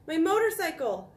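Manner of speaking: high, loud cry of alarm; boy's shout. A narrator's reading. Boy finding his motorcycle gone, shouting. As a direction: call out loudly as an upset boy. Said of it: "shouted the boy".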